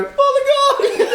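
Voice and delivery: tiny high squeaky Polygon call; pixie voice. Retold or imitated. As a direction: squeak it like a little creature. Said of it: "you hear a little voice in the back go".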